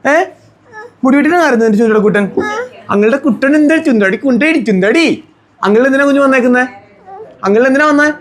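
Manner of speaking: high, doting sing-song questions. Real, not acted. Uncle asking his nephew why he cried, coaxing baby talk.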